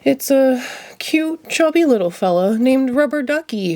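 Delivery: missing his little duck; mild wistful sigh in the voice; sad Ernie. A narrator's reading. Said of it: "Ernie sighed sadly".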